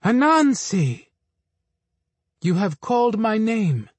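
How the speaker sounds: high, slow echoing call of the earth; booming Mother Earth. A narrator's reading. Mother Earth answering Anansi's call, slow and ringing. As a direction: answer like the wind and the trees. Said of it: "Mother Earth called back".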